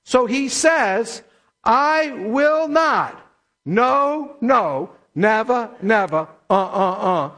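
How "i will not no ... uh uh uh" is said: slow, stretched "will not", then rhythmic refusals; emphatic negation. Shown as a demonstration. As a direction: hammer out "no, no, never, never" in separate beats. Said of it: "this is called emphatic negation".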